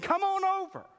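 high, loud come-on-over call; a cheery restaurant host calling; mock beckoning. A waiter in a vision beckoning churchgoers, high and loud. Olive Garden girl beckoning.

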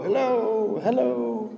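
high, soft echoes of a call; imitated echo. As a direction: echo it back softly, high.

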